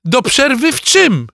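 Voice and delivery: put-on high, loud boyish query; baffled question. A narrator's reading. Alcest questioning the enraged boy about half-time, baffled. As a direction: ask in a high, puzzled voice. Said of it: "spytał Alcest".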